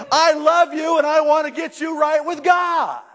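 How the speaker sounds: high, ringing preacher's cry; preaching at full force. Real, not acted. Preacher shouting love to the congregation, full force. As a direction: lift it up to the rafters.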